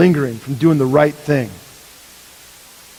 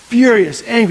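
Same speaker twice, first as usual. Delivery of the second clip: furious outburst; 7 semitones higher, 4 dB louder than usual. Acted. Preacher voices David's anger.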